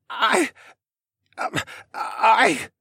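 high, strained, halting croak. A narrator's reading. Boy with crushed throat trying to speak, croaking. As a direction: force broken syllables from a sore throat. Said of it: "he croaked".

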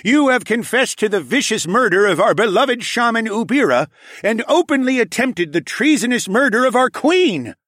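high, loud commanding king; roaring tyrant king. A narrator's reading. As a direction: thunder a royal sentence. King pronounces sentence.

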